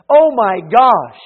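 loud, drawn-out mock outburst; put-on exclamation. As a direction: exclaim it slowly and loudly, in imitation. Mimics substitute exclamations he condemns.

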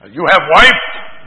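loud, slow mock Russian accent. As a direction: imitate a blunt Russian speaker, drawn out. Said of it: "Russian people will say".

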